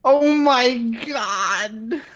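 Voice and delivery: high, slow, amazed exclaim; excited. Real, not acted. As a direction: stretch it out in delight. Party levels up.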